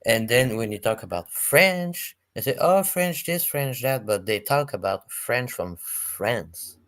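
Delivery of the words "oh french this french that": higher-pitched mock complaint; mocking imitation. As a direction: imitate them harping on, pitched up. Mimicking how Americans talk online.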